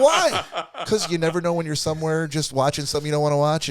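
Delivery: high, loud, laughing through it; cracking up while quoting. Retold or imitated. Retold exchange, laughing.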